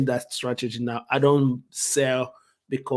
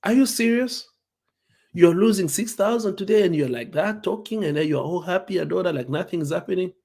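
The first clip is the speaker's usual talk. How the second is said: incredulous brother; 5 semitones higher than usual. Retold or imitated. Brother reacting to losing six thousand, high and incredulous. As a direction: act out the brother's shocked disbelief. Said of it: "he looked at me like".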